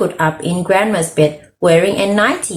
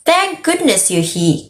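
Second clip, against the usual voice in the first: higher-pitched, mild exclamation; pitched-up storybook outburst at normal volume; relieved cry; 7 semitones higher than usual. Acted.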